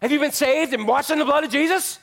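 hammed-up churchy zeal; pushy churchgoer. Churchgoer confronting a stranger, mock preachy and high. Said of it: "we come up to people and we say".

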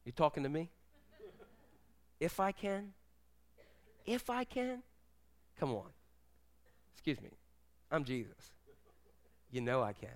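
hushed, mostly low tough-guy impression; quiet, incredulous challenge with long pauses; Robert De Niro. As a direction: play it soft and cocky, pausing between phrases. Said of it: "in his best Robert De Niro voice".

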